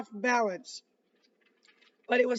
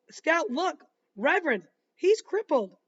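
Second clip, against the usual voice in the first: excited realisation; 6 semitones higher, 5 dB louder than usual. A narrator's reading. Jem realises Tom's arm is crippled.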